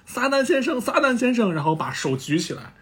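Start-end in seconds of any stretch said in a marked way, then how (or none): 0.1-1.5 high-pitched mock chanting; frenzied crowd cheer. All of Earth cheering for Satan, frenzied chant. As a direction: imitate a wild crowd without much extra volume. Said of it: "都在疯狂的喊着".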